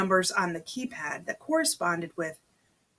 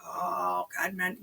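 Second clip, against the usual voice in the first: plain reading voice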